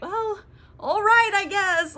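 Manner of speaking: high, slow, drawn-out giving in; hammed-up hesitant consent; mock reluctant agreement. Queen giving in to Oberon's wedding plan, mock reluctance.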